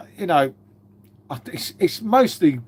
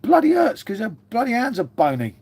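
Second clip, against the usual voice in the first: mock pained yelp; 5 semitones higher than usual. Joking to chat about bony hands, mock pained yelp. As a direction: yelp it like the slap still stings.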